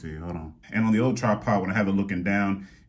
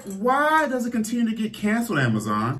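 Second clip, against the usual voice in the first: exasperated complaint; 12 semitones higher than usual. Retold or imitated. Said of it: "And I'm like".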